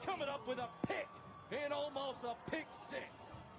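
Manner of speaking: high, shouted touchdown call, racing on the return; play-by-play call. Acted. Host calling a pick return for viewers, shouted play-by-play. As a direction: yell it like the crowd is on its feet.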